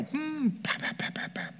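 soft whispered puffs of a devil sneaking in; breathy unvoiced pa pa pa, teasing; devil's mock muttering. Scene: devil slips in on the sad, whispered puffs. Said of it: "the devil to come in and go".

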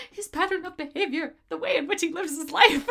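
put-on high, lightly frantic old man; old man impression. Friend repeating a newly noticed old man line, high voice. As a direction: recite it high, amused by the line.

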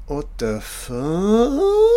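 real startled disbelief; shocked. Real, not acted. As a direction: caught off guard mid-sentence. Breaks off at screen surprise.

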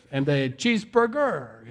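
slow, drawn-out mock-French accent; comic put-on French tourist; bad French accent. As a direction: order it with a fake French accent. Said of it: "put an accent on English".

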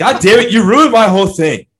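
loud mock anger through a laugh; loud, playful indignation; laughing outrage. Real, not acted. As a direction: blow up, half laughing. Co-host ruined his bit.

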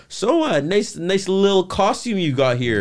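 high, slow, put-on interviewer voice; imitated high-pitched reporter; mock red-carpet interviewer. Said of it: "Laura Zima had asked her".

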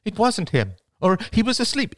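high, loud objection; insistent protest. Acted. Arguing that the missing man was someone else, insistent.

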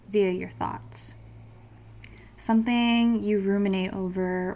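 soft, calm meditation guide; guided meditation. Acted. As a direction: lead them gently into calm.

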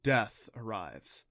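usual speaking voice

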